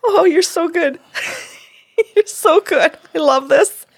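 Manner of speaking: high-pitched, laughing praise; tickled and delighted, voice lifted high; delighted laughter. Real, not acted. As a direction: gush through a laugh, pitched right up.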